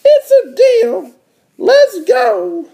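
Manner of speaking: very high, eager little-sister voice. Acted. Little sister accepting her brother's bet, high squeal. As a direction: pitch it way up like a cartoon kid sister. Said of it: "D W stuck her ha".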